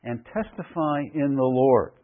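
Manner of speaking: slow, weighty stress through "testify in the Lord"; emphatic quotation. Shown as a demonstration. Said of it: "notice this phrase".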